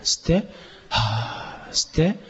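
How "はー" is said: short, much softer, lower "haa"; quick soft puff on the out-breath; short soft exhale. Shown as a demonstration. Teacher showing short breaths between inhales, softly. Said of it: "今度は短い音で".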